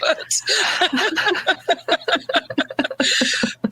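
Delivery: long self-mocking laugh; laughing at her own joke. Real, not acted.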